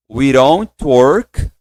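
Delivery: very slow, word by word; slow drill. Shown as a demonstration. Teacher drills the phrase slowly with the class. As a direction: stretch it out so students can follow. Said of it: "Vamos pronunciar a frase?".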